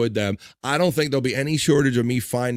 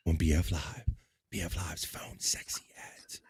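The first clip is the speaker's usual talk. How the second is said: whisper; 7 semitones lower, 14 dB quieter than usual. Acted. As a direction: breathe the title in a whisper. Whispers the segment title.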